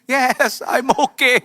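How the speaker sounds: high, weepy reenacted reply; mock tearful. Passenger in tears reassuring the flight attendant, tearful. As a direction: answer as if holding back tears. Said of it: "Saya dalam keadaan air mata bercucuran".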